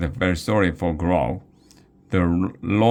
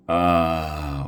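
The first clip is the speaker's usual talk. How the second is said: pained groan. Acted. Wiglaf groans after being yanked in and dropped. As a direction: groan as you hit the cold stone floor. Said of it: "Wiglaf groaned".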